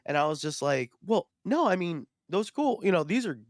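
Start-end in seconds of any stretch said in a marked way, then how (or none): 1.0-3.4 high, hasty reassurance; flustered backpedalling. Retold or imitated. Seller trying to undo a customer's regret, flustered and high. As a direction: scramble to reassure, pitch jumping up.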